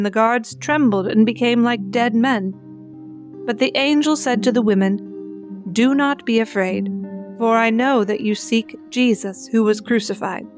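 ordinary talk, nothing marked

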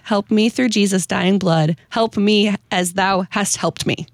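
usual speaking voice

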